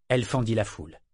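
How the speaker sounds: plain reading voice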